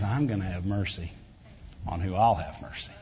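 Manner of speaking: put-on a father quietly promising his children mercy; gentle. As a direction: say it softly like a loving father. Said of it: "that's not what God said".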